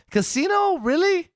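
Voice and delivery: high, shocked disbelief; can't believe what you just heard; disbelieving. Real, not acted. Disbelief at friend's pick.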